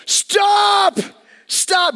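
high, urgent re-enacted shout; yelled warning. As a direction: yell to halt a driver out of earshot. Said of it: "I yell out".

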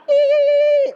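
very high, loud dolphin-like squeal of joy; gleeful imitated dolphin noise; Flipper squeal. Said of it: "He makes this little flipper noise".

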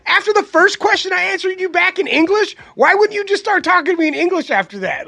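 high, loud, fed-up rant at the taco lady; exasperated yell. Retold or imitated. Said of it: "And I was like".

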